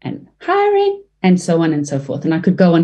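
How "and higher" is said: "hiring" squeaked up high and slow; drawn-out high note; high demo. Shown as a demonstration. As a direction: jump way up on "hiring". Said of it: "and hiring".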